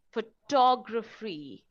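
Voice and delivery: PHOTOGRAPHY slowly, with a strong TOG, pho-TOG-raphy; second-syllable stress. Shown as a demonstration. Teacher shows word stress to learners, second syllable stressed. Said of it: "the stress is on the second syllable".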